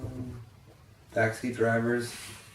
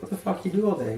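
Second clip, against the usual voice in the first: incredulous; 7 semitones higher, faster than usual. Retold or imitated. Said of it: "And I was like".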